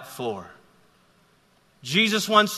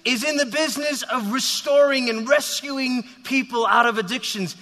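higher, eager imagined follower; a touch of excited rumour; eager hearsay; 7 semitones higher than usual. Acted. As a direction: lightly act a keen bystander passing on news. Voices an eager follower from afar.

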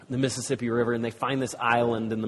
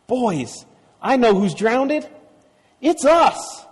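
high, rising to a loud peak; excited exclamation; 11 semitones higher, 6 dB louder, slower than usual. A narrator's reading. Tom reveals to the boys who has drowned, thrilled. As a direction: build to a thrilled revelation. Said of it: "and he exclaimed".

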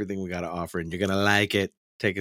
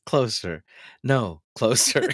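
higher mock coaxing, breaking toward laughter; imitating co-host; 6 semitones higher, 4 dB louder than usual. Host mimicking co-host's PSA, mock coaxing. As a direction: mimic her coaxing, drawing out the last word. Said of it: "when you were saying".

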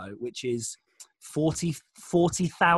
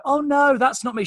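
higher, louder feigned protest; mock false modesty; 8 semitones higher, 5 dB louder than usual. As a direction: play at brushing off praise. Girl mimicking false modesty.

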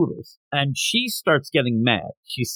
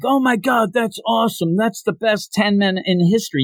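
high, thrilled gushing; excited; 6 semitones higher than usual. Retold or imitated. Onlookers outside gushing over the ramen, high and excited. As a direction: gush like a wowed onlooker. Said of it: "we're like".

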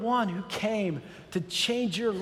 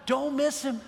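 usual speaking voice

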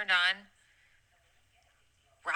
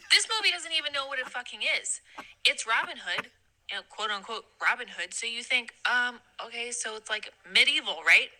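normal talking voice

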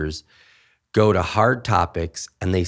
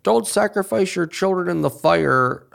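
higher, hammed-up Old Testament decree; mock solemn command; 11 semitones higher than usual. Quoting an obscure Old Testament law, mock. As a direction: intone it like an odd ancient rule.

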